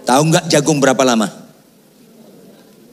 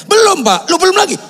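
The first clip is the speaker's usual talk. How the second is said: high, raised mock exasperation; exasperated correction; 4 dB louder than usual. Teak grower telling the pastor not yet, mock exasperation. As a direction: imitate an expert cutting off an eager learner.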